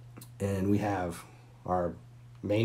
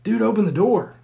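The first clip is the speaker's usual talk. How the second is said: much higher and louder shouting; loud demand; 13 semitones higher, 11 dB louder than usual. Retold or imitated. Buddy demanding through the door, shouting. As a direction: bang out the demand. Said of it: "He's like".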